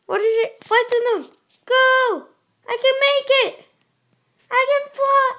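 high, loud eager shouts; loud hype while racing the clock; excited yelling. Real, not acted. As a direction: shout it, urging yourself on. Hurrying to make the jump.

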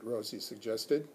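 usual speaking voice

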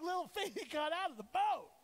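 high, loud incredulous objection; indignant protest. Retold or imitated. Preacher defending Peter against the rebuke, indignant. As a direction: protest like it's unfair. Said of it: "I'm like".

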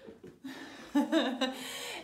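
light laugh. Real, not acted. Laughs after closet joke.

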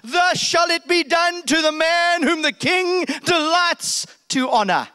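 high, loud herald cry; loud proclamation. Acted. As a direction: announce it to the whole square. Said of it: "nice and loud".

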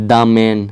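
the man, "the" said "daa". Shown as a demonstration. Teacher recapping examples for learners, "daa man". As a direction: open "the" to "daa" before man. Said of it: "এটার ক্ষেত্রে কী ছিল?".

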